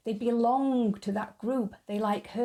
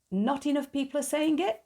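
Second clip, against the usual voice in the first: incredulous echo; 6 semitones higher than usual. Real, not acted. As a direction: pitch jumps higher in disbelief on the whole line.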